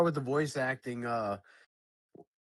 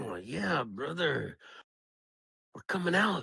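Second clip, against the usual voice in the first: Macho Man; 4 dB quieter than usual. Retold or imitated. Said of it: "throw us a Macho Man".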